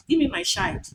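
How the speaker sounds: put-on "child" said as "shild"; mispronunciation demo. Shown as a demonstration. Lecturer mimicking a common error, sh in "child". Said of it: "not child".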